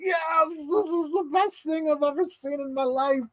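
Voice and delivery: high, breathy-thin squeal of joy; gushing delight. Retold or imitated. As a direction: squeal it like a thrilled fan. Excited retelling of own reaction.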